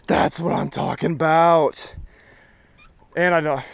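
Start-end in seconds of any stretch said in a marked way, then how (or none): 0.0-2.0 higher, louder drawn-out "yeah"; triumphant cheer. Real, not acted. Angler celebrating a personal best, triumphant cheer. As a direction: savour the win out loud.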